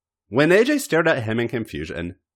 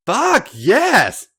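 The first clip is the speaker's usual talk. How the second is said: high, loud cry of release; loud cry of climax; ecstatic shout; 14 semitones higher, 6 dB louder than usual. Acted. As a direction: let it burst out, high and loud. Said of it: "He groaned".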